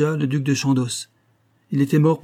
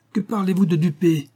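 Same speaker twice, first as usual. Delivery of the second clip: much higher, raging question; enraged retort to an insult; furious outcry; 7 semitones higher than usual. A narrator's reading. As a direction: fling the accusation back at him. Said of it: "s'écria-t-il".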